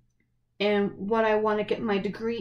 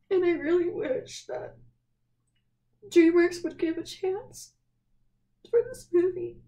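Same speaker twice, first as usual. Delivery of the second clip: higher, choked-up teary pleading; tearful plea; 8 semitones higher than usual. Real, not acted. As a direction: hold back tears while begging. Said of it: "I've been crying".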